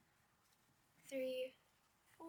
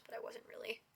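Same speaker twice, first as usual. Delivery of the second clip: softer whispered take-back; whispered aside; 11 dB quieter than usual. Real, not acted. Admitting to viewers it was a joke: sheepish whisper. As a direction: let it slip out as a quiet whisper.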